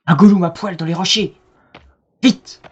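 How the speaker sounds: loud eager call; excited. Real, not acted. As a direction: shout it out excitedly. Spots creature in rocks.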